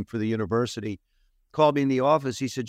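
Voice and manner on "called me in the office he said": usual speaking voice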